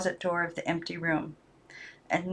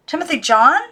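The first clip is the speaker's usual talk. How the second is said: high, louder questioning call; anxious call; 8 semitones higher, 7 dB louder than usual. Acted. Girl turns and calls the other doll.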